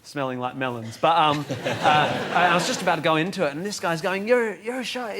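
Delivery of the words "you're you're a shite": high-pitched mock heckle; aggressive heckler. Comedian retells this guy's heckle, mildly mock-aggressive. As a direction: imitate an aggressive heckler without raising volume. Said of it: "He's just being really aggressive".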